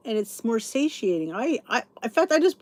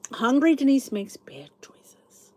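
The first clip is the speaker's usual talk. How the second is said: a slow, voiceless stage whisper; playful whispered aside; 4 semitones higher, 4 dB louder, slower than usual. Pretend. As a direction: confide it like a secret, slowly. Stage whisper about herself.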